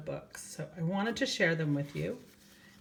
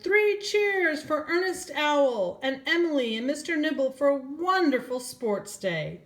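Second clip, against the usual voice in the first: higher, louder cheering pig; cheering shout; 10 semitones higher, 7 dB louder than usual. Acted. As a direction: lead the crowd in three cheers. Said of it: "Henry the Pig shouted".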